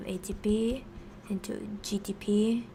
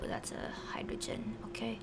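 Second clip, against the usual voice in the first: whispered note to self; whispering; 10 dB quieter than usual. Real, not acted. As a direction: breathe it out under your breath. Muttering to self while writing.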